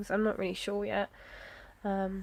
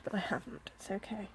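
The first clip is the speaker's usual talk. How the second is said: whispering; 10 dB quieter than usual. Real, not acted. Relieved aside about the sticker.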